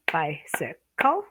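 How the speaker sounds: "bicycle" slow, split into clapped beats bi-cy-cle; clapped syllables. Shown as a demonstration. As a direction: clap out each beat of "bicycle" slowly. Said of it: "you can clap for your students".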